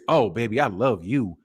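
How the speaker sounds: low, soft come-on purr; seductive. Shown as a demonstration. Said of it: "That means I wanna get some".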